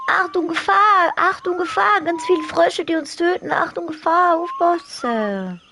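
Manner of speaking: high, loud warning voice; alarm voice. Pretend. Warning cry in a put-on voice.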